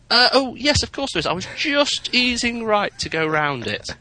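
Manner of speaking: high-pitched, drawn-out feigned innocence; hammed-up sheepish excuse in a high voice; mock innocent reply. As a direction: jump up high, caught out and covering. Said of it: "And I sort of went".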